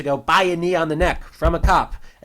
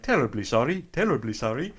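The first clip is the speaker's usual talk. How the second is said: put-on polite old man. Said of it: "super polite to everybody".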